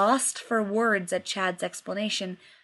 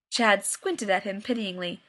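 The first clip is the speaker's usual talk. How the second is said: ordinary delivery, nothing marked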